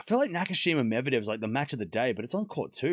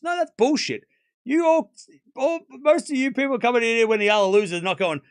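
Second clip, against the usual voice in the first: much higher and louder, furious; angry outburst; 10 semitones higher, 6 dB louder than usual. Real, not acted. Streamer yelling at rival fans in chat, furious. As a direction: blow up at the chat, pitch way up.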